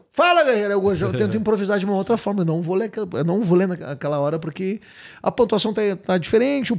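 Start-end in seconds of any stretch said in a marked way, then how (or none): 0.1-0.7 high, punchy greeting in character; resposta improvisada. Retold or imitated. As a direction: burst in with a hearty hello. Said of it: "pum, deu". (saudação animada, em tom alto)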